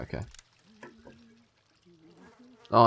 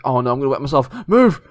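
panicked yell; 4 semitones higher, 8 dB louder than usual. Real, not acted. Panicking in game.

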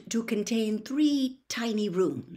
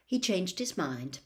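usual speaking voice

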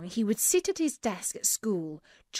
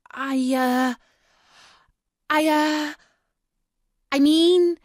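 high, loud, hesitant stammering; nervous; 7 semitones higher, 7 dB louder than usual. A narrator's reading. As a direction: falter nervously, pitch climbing with each try. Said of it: "said Jack nervously".

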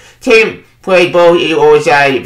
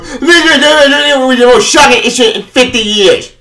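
higher, slow announcer-style mock read; mock hype quote; 7 semitones higher than usual. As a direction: parody the hype line.

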